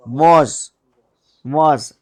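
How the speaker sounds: वॉज rhyming with 'cause', not with 'case'; correct pronunciation. Shown as a demonstration. Teacher showing students how VASE is read, correct pronunciation. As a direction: say वॉज with a long open 'aw' vowel. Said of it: "इसको वेस नहीं पढ़ना".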